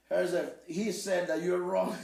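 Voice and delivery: laughing through the words; can barely get it out for laughing. Real, not acted. Translator passing on the cheeky reply, laughing. Relaying the insult, cracking up.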